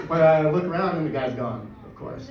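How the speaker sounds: ordinary delivery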